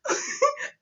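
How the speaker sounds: breathless high giggle; helpless laughing squeal. Real, not acted. Laugh continues.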